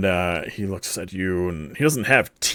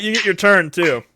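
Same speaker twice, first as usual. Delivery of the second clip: ordinary talk, nothing marked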